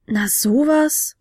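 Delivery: slow, drawn-out wonder; astonished. A narrator's reading. Fionella seeing the tiny creature, astonished. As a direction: stretch it out in amazement. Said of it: "staunte Fionella".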